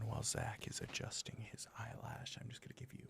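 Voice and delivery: soft voiceless ASMR whisper. Acted. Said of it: "We'll do a little A S M R talking".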